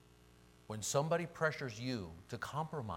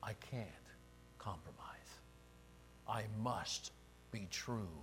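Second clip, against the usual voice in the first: hushed resolve; 2 semitones lower, 8 dB quieter than usual. Acted. Voicing the prophet's personal resolve.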